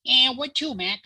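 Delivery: gruff Brooklyn cabbie; high, loud Brooklyn cab driver; Brooklyn accent. Retold or imitated. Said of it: "the cab driver says".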